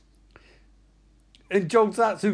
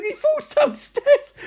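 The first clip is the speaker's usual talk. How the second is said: very high, loud, drawn-out squeal; high, slow, hysterical glee; gleeful outburst; 5 dB louder, slower than usual. Retold or imitated. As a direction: squeal the fall in delight. Cracking up at villain's fall.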